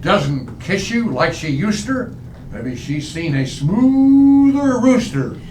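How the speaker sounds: normal talking voice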